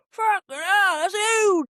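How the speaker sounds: high, loud mock astonishment; loud teenage boy. Boy on the bus spots a swollen foot, mock shock. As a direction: blurt it out like a gobsmacked lad. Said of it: "this one boy at one point".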